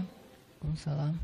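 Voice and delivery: low, soft, slow sulky mumble; a sulking wife grudgingly returns the greeting, low and soft; sulky reply. Retold or imitated. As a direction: answer the greeting grudgingly, barely audible. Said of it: "Kayak ngambek gitu kan".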